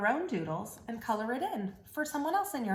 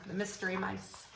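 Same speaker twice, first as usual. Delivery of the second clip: ordinary delivery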